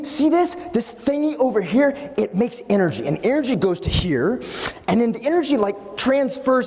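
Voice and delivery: put-on an excited boy proudly explaining his invention; eager kid explaining. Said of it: "and he says".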